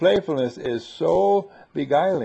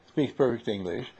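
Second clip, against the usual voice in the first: usual speaking voice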